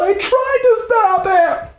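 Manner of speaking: slow, high, shouted wail; Brandy wailing her defense at dad. Acted.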